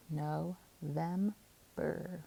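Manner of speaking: "no, them, burr" as three separate syllables. Shown as a demonstration. Teacher putting a word together, "no, them, burr" in separated syllables. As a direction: pause between "no", "them" and "burr". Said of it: "All together".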